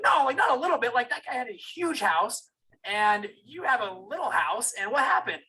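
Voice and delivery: high and loud, pressing for answers; demanding. Retold or imitated. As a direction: push dad hard for the truth. Confronting dad about money.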